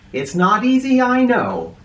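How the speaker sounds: high, eager aside; lively aside. A narrator's reading. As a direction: lean in and admit it. Narrator boy's aside to listeners.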